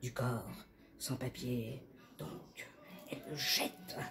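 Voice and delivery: soft, fading to a whisper; hushed narration. A narrator's reading. Narrator as La L throws away the paper, hushed. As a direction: wind down step by step.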